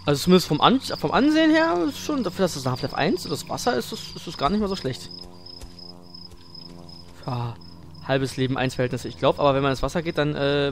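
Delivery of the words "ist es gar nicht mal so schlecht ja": normal talking voice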